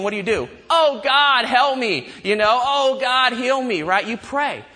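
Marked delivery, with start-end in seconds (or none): 0.7-2.1 loud, high mock plea for help; urgent raised-voice plea; desperate prayer. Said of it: "when you're in a desperate situation". 2.6-3.8 loud, high mock plea for help; urgent raised-voice plea; desperate prayer. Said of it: "when you're in a desperate situation".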